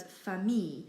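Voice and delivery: FAMILLE as "fa-mee"; silent L. Shown as a demonstration. Teacher naming the often mispronounced word, silent L. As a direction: say FAMILLE ending on "ee", no L. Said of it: "it's just famille".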